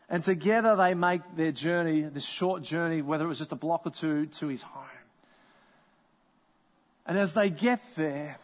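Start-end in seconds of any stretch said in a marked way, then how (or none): none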